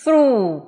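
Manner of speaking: "Through" loud, ending in a pure oo; ends on u. Shown as a demonstration. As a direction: model "Through" with a silent gh. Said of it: "Chỉ phát âm là u là hết".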